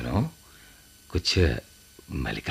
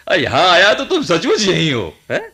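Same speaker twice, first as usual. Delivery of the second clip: louder, higher exclamation of finding him; surprised; 7 semitones higher, 7 dB louder than usual. Acted. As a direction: burst out in surprise. Finds him at the temple.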